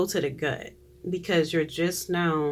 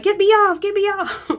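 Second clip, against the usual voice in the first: high-pitched frantic shriek; panicked yelling; 8 dB louder than usual. Acted. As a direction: yell to be let off the ride, very high. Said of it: "Like that".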